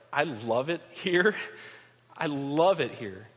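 slow, drawn-out, unconvincing protest of love; strained insistence. Acted. Residents insisting they love living here, strained. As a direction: stretch it out as if convincing yourself.

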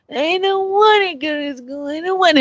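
high whining child, imitated; whiny kid. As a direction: whine like a sulky little boy. Child whining about school.

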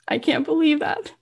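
high-pitched retold astonishment; amazed disbelief. She learns she sold out, amazed disbelief. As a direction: lift it high in stunned disbelief.

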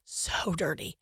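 whispered aside of disgust; hushed disgust. Real, not acted. Co-host reacting to bare feet in stores, hushed disgust. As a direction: drop to an unvoiced whisper, grossed out.

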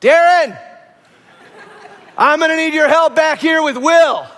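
loud call from the back of the house. Retold or imitated. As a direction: call the husband for help, loud and firm. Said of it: "she goes".

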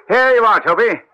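high, bright call to the dog; coaxing a dog. Acted. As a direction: Holmes urging the tracker dog onto the scent.

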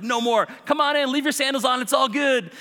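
high, loud, eager invitation; excited welcome. Acted. New believer inviting people into his house, excited.

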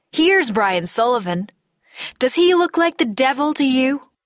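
rising, heated challenge; forceful confrontation. Acted. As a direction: demand that he look, pitch climbing. Confronting him with the head.

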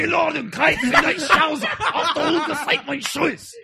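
high-pitched hammed-up German bark, laughing; yelling German. Angry German version asked for by his co-host, high and hammy. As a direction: shout the German like an angry movie officer. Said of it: "I'll do the yelling one".

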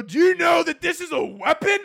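high shouted accusation; guard scolding over a razor; angry guard. Retold or imitated. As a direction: yell at the traveler.

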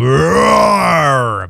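low growling beast roar; werewolf roar. Shown as a demonstration. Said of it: "c'est mon imitation, effectivement, d'un loup-garou". (rugissement de loup-garou)

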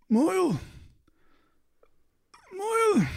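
very high, thin whimper of a name; faint pained cry from down an alley. Acted. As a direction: call out weakly in a high whimper. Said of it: "you hear a whimpering sound".

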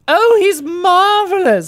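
slow, high theatrical drawl; hammy stage actor. Acted. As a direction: gush like a stage ham. [name] in character.

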